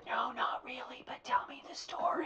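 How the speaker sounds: conspiratorial whisper. Retold or imitated. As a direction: whisper back playing along. Playing along conspiratorially.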